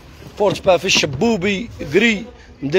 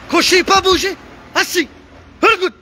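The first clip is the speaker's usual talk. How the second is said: high-pitched calling; 8 semitones higher than usual. Real, not acted. Owner calls high to the pet to play dead. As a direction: sing out the orders high to the animal.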